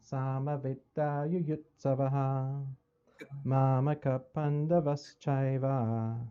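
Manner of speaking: slow, measured chanting of a verse; slow Sanskrit recitation. Shown as a demonstration. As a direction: recite each word slowly and solemnly. Recites first Gita verse.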